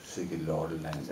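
slow, low-key Danish "Sikke lorteland" under the breath; a softly muttered Danish oath after a fall; muttered Danish curse. Retold or imitated. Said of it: "when I fall down and I say".

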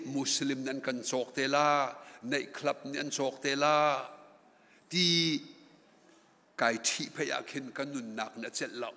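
ordinary talk, nothing marked

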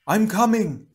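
high, loud call; high-pitched rescue yell; shout. A narrator's reading. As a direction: shout that help is coming. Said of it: "Leiwa shouted".

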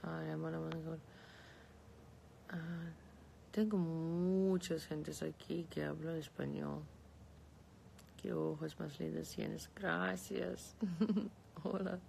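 ordinary talk, nothing marked

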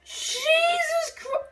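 overwhelmed. Real, not acted. Overwhelmed by the reveal.

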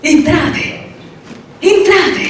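high, beaming invitation to come in; a radiant old painter calling guests inside; joyful welcome. A narrator's reading. Old painter welcomes the two visitors, beaming with joy. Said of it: "raggiante di felicità".

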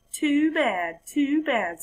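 high, dismissive sing-song; smug frog. Acted. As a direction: brush it off with smug pity.

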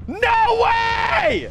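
high, loud disbelief; shocked shout. Real, not acted. Gamer stunned by the game, shocked shout. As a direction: yell it in shock. Something surprising appears.

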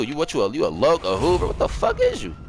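high-pitched, incredulous demand; heated interrogation. Real, not acted. Player demanding a rival's gang, agitated and high. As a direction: fire questions at him, pitch jumping up.

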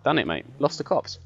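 ordinary talk

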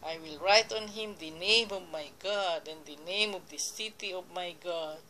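usual speaking voice

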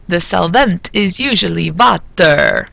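put-on slow corny Transylvanian accent, "water" as "vatter"; cheesy Transylvanian movie accent; bad Transylvanian accent. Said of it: "that's the end of my bad accent".

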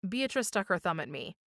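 ordinary delivery, nothing marked

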